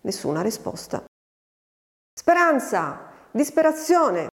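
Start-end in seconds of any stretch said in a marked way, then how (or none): 2.1-4.3 high, drawn-out call of the names; insistent calling. A narrator's reading. Farmhand calls the unanswering girl, insistent calling. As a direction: call out impatiently for someone who won't answer. Repeated call, nobody answers.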